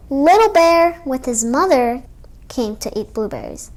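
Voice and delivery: ordinary delivery, nothing marked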